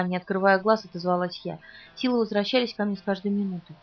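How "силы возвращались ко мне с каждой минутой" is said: ordinary delivery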